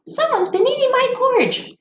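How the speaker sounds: very high squeaky little bear. Shown as a demonstration. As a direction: read it like the tiny bear in a storybook. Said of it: "we read to little kids".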